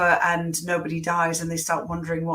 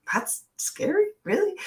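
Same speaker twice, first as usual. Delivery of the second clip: a squeaky, mock-incredulous "really?"; 12 semitones higher than usual. Recalling the editors calling her scene scary, high incredulous squeak. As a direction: voice shooting up high in disbelief.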